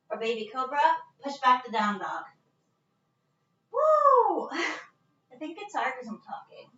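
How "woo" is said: high, loud whoop of effort; excited whoop. Real, not acted. Yoga teacher whooping at her class, high and loud. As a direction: let out a joyful woo.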